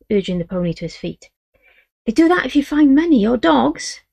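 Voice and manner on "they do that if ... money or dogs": put-on hopeful boy. Boy voiced.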